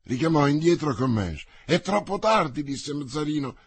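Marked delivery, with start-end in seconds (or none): 1.6-2.6 raised pitch, distraught exclamation; a touch of desperation, voice lifted; despairing exclamation. A narrator's reading. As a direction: exclaim it as if all is lost. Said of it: "strappandosi i capelli".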